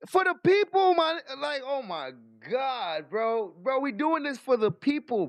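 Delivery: exasperated shout. Real, not acted. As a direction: shout your frustration, bro. Venting outrage at the official.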